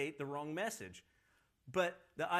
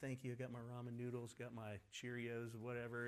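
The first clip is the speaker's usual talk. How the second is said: casual shopper; 5 semitones lower, 9 dB quieter than usual. Pretend. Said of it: "you go to the grocery store, it's like".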